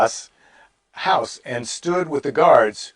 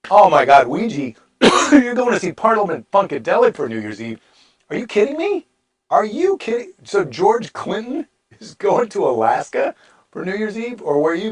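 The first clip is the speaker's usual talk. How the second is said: high, excited, incredulous outburst; amazed disbelief; 8 semitones higher, 4 dB louder than usual. Real, not acted. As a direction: react as if you can't believe it. Exclaims at viewer's concert plans.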